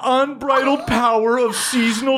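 high, mocking sing-song reading of mantras; gloating mock mantra. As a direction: read her diary mantras aloud to mock her. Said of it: "She's going".

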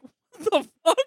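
high, loud baffled outburst; retold disbelief. Storyteller relives hearing an unexpected voice, incredulous outburst. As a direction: act out being totally thrown, pitched right up.

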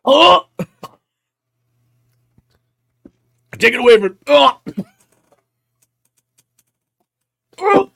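loud gagging cries of disgust; nearly throwing up, crying out. Real, not acted. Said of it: "[name] literally almost threw up".

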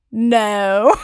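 low, amused Rickman no; a dry, teasing refusal; Alan Rickman voice. Retold or imitated. Imitating Alan Rickman teasing a fan, low and dry. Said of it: "and he smiles, and he winks, and he goes".

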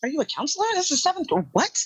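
high, disbelieving retort; incredulous. Retold or imitated. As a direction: ask it in shocked disbelief. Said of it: "And I'm like".

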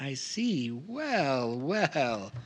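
soft, swooping musing; knowing amusement. Acted. Informant sizing up a haggler, knowing amusement. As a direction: muse it slowly to yourself, impressed.

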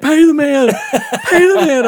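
high, loud, frantic urging; hammed-up shrill panic at gunpoint; mock panicked pleading. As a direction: imitate a terrified friend begging someone to pay up. Friend urges paying at gunpoint.

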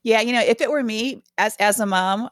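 ordinary talk

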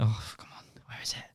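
muttered under the breath; hushed frantic search to himself; whispered panic while rummaging. Retold or imitated. Retold searching pockets.

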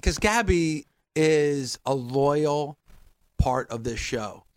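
low, slow, measured declaration; solemn defence. Real, not acted. Host vouching for his co-host's loyalty, solemn. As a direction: spell it out slowly and gravely.

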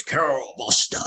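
imitated booming call, drawn out. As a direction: call the name as if across a big room. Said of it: "this booming voice goes".

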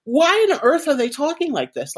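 high-pitched exasperated question; baffled inner protest. Retold or imitated. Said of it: "I'm having this whole conversation".

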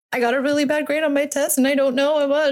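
imitating her daughter's upset tumble of words; upset teen daughter. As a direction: rush it out like a distraught teen. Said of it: "And she's really upset".

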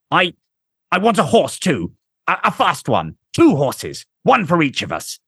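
high, stammering nervous officer; flustered commander. A narrator's reading. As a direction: blurt demands in a fluster.

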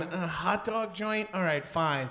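soft, grudging inner voice. Retold or imitated. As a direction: mutter it to yourself, grudgingly. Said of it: "I was in my head going".

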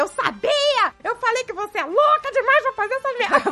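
high-pitched mock angry scolding; hammed-up, high I-told-you-so rant; retold pissed-off outburst. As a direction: imitate a fed-up partner blowing up. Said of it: "Aí o Dave começou a ficar puto".

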